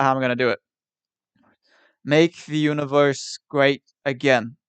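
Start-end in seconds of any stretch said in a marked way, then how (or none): 2.0-4.6 put-on slow, light New York accent on the slogan; a hint of a New York Trump slogan, drawn out. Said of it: "Kind of with a bit of a New York accent".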